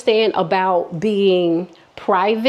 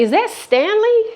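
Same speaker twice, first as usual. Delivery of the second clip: much higher disbelieving question; shocked; 12 semitones higher than usual. Real, not acted. Seeing his name in the texts, shocked high question. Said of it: "shocked recognition".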